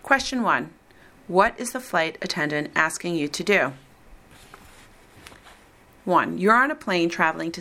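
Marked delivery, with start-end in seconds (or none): none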